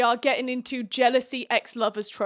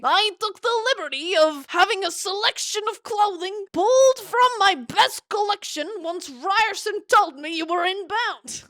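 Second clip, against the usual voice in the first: put-on much higher, theatrical Dracula accent; 10 semitones higher, 4 dB louder than usual. Viscount Takaris offers clothes and calls his niece, Dracula accent. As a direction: play the host as a high-pitched Dracula. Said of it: "gonna be doing that accent for Takaris".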